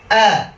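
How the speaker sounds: hard, high "আ" from the throat; short, loud throaty "আ". Shown as a demonstration. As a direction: punch "আ" out with a glottal onset. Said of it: "আটা গলা থেকে আসে".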